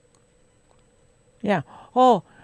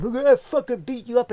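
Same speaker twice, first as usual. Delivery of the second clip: higher-pitched mock angry threat; imitated mad threat; 7 semitones higher than usual. Mad co-worker threatening the supervisor, imitated anger. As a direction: mimic a worked-up man threatening. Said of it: "got all mad".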